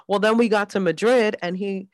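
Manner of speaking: ordinary talk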